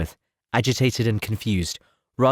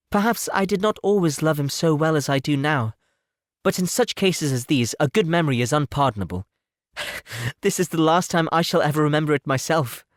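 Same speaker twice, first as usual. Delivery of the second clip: put-on higher, lightly teasing young lady; playful Elizabeth; 7 semitones higher than usual. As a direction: wave the past away with a laugh. Elizabeth brushing off the past.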